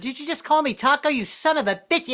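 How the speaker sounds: high offended woman voice; insulted woman. Retold or imitated. Comic imagining a woman called Taco, indignant. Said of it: "if I were a woman".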